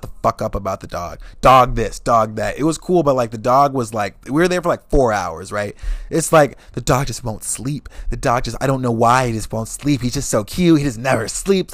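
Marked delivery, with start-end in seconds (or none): none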